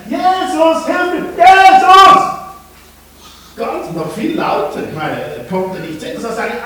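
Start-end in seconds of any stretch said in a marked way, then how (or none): 0.1-2.2 very loud, high, drawn-out shout; shouting louder. Acted. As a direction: yell it out with all your might. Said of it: "er rief einfach lauter".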